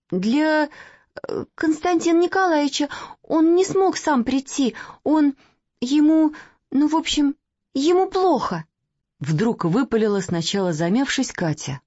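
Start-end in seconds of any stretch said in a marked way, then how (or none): 0.1-8.6 put-on hesitant little girl. Katya blurts out, hesitating.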